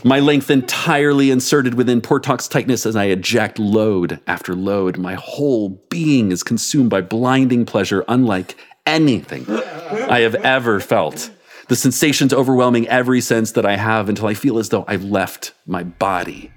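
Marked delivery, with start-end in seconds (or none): none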